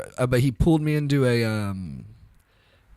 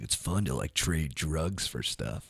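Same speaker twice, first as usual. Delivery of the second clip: slow, low and soft, playing a dim kid; mock the kid who thought this was cool; a lazy, half-mocking stoner drawl; dopey younger self; 7 semitones lower, 7 dB quieter, slower than usual. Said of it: "I think I was just like".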